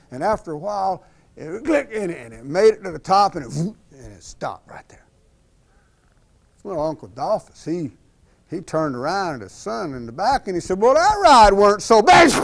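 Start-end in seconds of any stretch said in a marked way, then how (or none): 3.3-5.1 whispered, hushed suspense. A narrator's reading. Narrator builds suspense at the coaster's peak, whispering. 10.8-12.3 put-on higher, louder cocky old farmer. Uncle Dolphus boasts to his son after the climb, loud and cocky.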